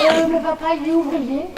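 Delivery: put-on high little boy voice; child's voice. Comic recalls himself as a pupil, high child voice. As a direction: pipe up like a shy little boy.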